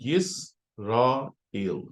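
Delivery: "यस रा एल" said very slowly, syllable by syllable with gaps; teacher splits "यस रा एल" into three slow separate syllables; syllables spaced out. Shown as a demonstration. Teacher sounds out the syllables slowly for the students. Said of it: "तीसरा syllable बनेगा एल".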